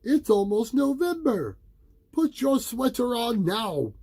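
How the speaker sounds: high, loud scolding mother; stern mother. A narrator's reading. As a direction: order him firmly. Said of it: "cried his mother".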